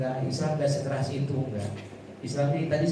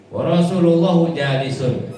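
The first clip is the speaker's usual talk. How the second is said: raised recitation; 6 semitones higher, 8 dB louder than usual. Acted. Preacher reciting hadith Arabic.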